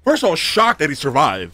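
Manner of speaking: excited. Real, not acted. Amazed rant.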